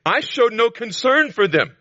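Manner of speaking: "concern" hit high and loud; preached verse. Acted. Preacher declaring God's judgment high and loud. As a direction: proclaim "I showed no concern for them" high and loud.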